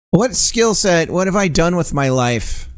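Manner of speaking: high, hammed-up wail of regret; mock dramatic despair. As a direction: play the crisis big. Mock existential crisis, high.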